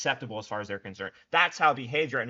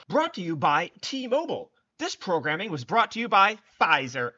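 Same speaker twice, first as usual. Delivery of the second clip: higher, louder mock commercial announcer; 6 semitones higher, 5 dB louder than usual. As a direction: read it like a cheesy TV sponsor tag. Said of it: "and they're like".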